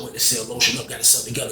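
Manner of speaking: ordinary talk, nothing marked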